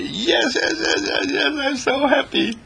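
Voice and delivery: high, delighted yes yes yes; overjoyed. Acted. Speaker greeting listeners, overjoyed and high. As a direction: bubble over with happiness. Joyful opening exclamation.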